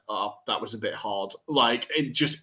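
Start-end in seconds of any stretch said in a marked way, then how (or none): 0.1-1.3 lightly voiced footballer, quiet and rueful; a mild, rueful mutter to himself; imagined player's remark. Imagining the defender after his own goal, mild rueful. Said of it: "I imagine that he passed it and went".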